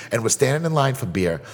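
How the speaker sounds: normal talking voice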